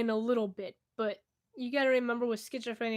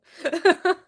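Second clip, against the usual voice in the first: sudden loud laugh, pitched up; burst of laughter; 7 semitones higher, 14 dB louder than usual. Real, not acted. Laughing at her cat's defiant look. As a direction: break out laughing at the cat.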